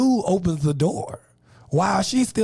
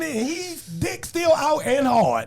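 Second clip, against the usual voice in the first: ordinary talk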